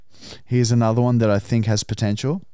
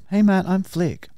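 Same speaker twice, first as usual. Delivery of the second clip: put-on girly greeting=83; 9 semitones higher than usual. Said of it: "I gave her your number".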